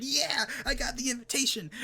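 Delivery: high, excited mock cheer; hyped up. Hyped fighter celebrating getting the invitation, excited. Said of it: "they're all hyped up".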